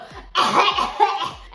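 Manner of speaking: loud high laugh; burst of laughter. Real, not acted. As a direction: laugh out loud mid-cough. Laughing while forced to cough.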